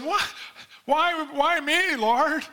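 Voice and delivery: high, slow, whiny protest; a reluctant man whining at God; whining Moses. Acted. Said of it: "Moses, quit whining".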